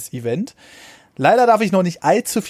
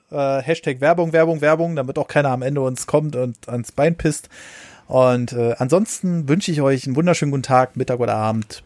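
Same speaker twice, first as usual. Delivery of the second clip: ordinary talk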